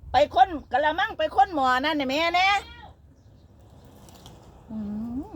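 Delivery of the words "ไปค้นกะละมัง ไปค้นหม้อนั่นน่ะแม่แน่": loud, high call across the house. Real, not acted. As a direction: shout over to mom in another room. Calling out to mom off camera.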